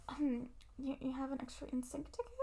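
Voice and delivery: timid ask. Retold or imitated. Farron timidly overhears and asks.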